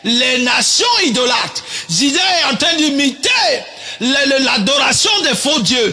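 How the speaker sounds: forceful preacher in full flow; preaching. Acted. Preacher denouncing Judah to the congregation, preaching forcefully.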